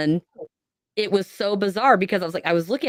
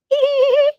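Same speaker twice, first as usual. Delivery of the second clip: high imitated child's giggle; creepy giggling; 6 dB louder than usual. Child giggling behind mom in the dark kitchen, high and eerie. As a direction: giggle like an unseen kid behind her.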